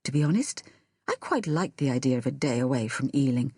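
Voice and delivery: plain reading voice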